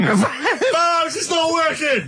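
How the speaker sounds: high-pitched mock yell, words stretched out; imitated shouting. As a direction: mimic someone shouting over headphones. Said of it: "he was just shouting".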